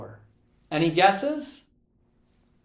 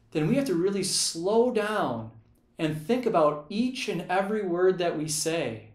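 normal talking voice

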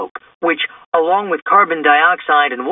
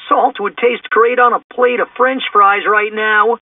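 higher-pitched, food-loving slacker; a hint of goofy cartoon hunger; lightly voiced hungry Shaggy; 6 semitones higher than usual. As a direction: lightly play a hungry goofball. Plays Shaggy in the story.

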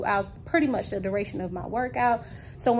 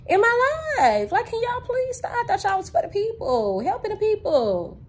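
exasperated plea; 9 semitones higher than usual. Real, not acted. Pleading with YouTube over copyright claims.

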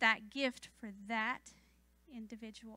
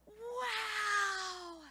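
put-on high, drawn-out toddler wow; child's wonder; 9 semitones higher than usual. Toddler seeing the stars, imitated childlike awe. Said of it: "just with the wonderment of a child".

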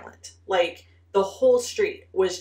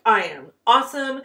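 smug boast; 4 semitones higher, 6 dB louder than usual. Retold or imitated. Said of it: "I was like".

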